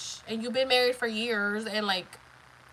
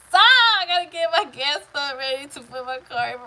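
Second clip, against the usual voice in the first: laughing joke; 4 semitones higher, 10 dB louder than usual. Real, not acted. Joking and laughing with viewers.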